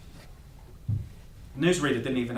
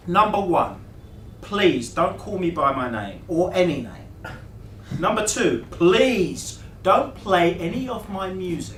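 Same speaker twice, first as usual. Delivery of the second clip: slow, loud, high list of demands; emphatic rule-giving; 7 semitones higher, 7 dB louder, slower than usual. A narrator's reading. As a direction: lay down the law, stretching each point. Laying down two rules loudly.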